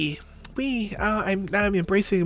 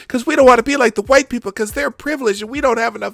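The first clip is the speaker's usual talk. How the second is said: sarcastic mock; 7 semitones higher, 4 dB louder than usual. Mocking the clip's speaker.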